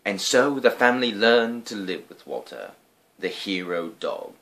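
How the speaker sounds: ordinary delivery, nothing marked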